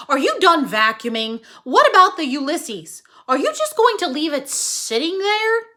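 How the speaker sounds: loud nagging call across the yard. Acted. Mister Tickum calling to his wife from the house, loud. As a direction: holler it from the house. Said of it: "Mister Tickum called out".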